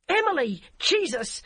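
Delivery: high, loud despairing cry. A narrator's reading. Barbara cries out at the DCI, high loud cry. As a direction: cry out in disbelief. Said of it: "Barbara cried".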